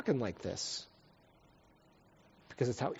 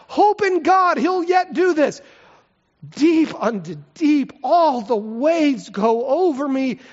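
loud, high anguished psalm reading; preaching at full force; 10 semitones higher, 8 dB louder than usual. Acted. Preacher to congregation, voicing the psalmist, anguished and loud. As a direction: cry it out like a desperate psalmist.

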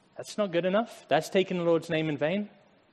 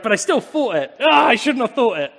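mock regret; 6 semitones higher, 8 dB louder than usual. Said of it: "But then I'm like".

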